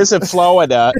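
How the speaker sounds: brief high Boston impersonation; broad Boston accent. Retold or imitated. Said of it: "she had that Boston".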